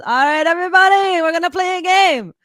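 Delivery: loud, high party emcee hype; imitating a cheesy resort DJ on the mic. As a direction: pump up the pool crowd like a resort DJ. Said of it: "the D J goes".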